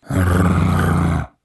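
a rolling menacing growl; wolf growl. A narrator's reading. Wolf growling at the boy and cats, menacing growl. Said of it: "Вышло довольно грозно".

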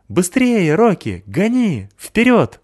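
high, loud, urging yell; excited shouting. Acted. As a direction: cheer the dog on at full voice. Boy urging dog on. (восторженный громкий крик)

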